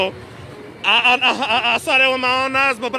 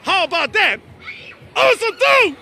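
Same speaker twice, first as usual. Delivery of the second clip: excited shouting; 7 semitones higher than usual. Real, not acted. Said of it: "I haven't raised my, my voice like this in a while".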